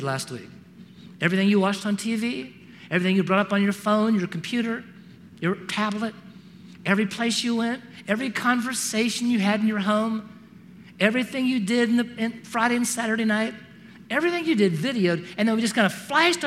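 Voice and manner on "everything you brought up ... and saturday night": ordinary talk, nothing marked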